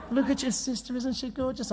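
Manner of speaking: high, hushed admiring coo; doting parent. Retold or imitated. Parent holding up sister as the example, soft and doting. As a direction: gush softly over a lovely child. Parent praising one child to another.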